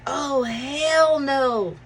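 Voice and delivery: slow, high "hell no"; drawn-out disgust. Retold or imitated. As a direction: draw it out in disgust. Liz reacting to vomit.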